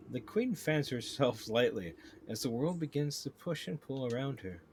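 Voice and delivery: ordinary talk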